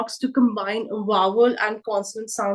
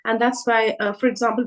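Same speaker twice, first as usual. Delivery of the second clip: ordinary delivery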